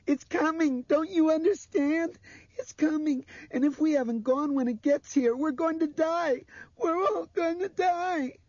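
put-on shrill, loud child near sobbing; terrified screaming. A narrator's reading. Blind girl warning they will all die, terrified screaming. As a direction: build from screaming panic towards tears. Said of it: "Her voice cracked and she began to sob".

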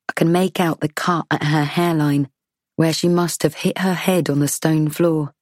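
plain reading voice

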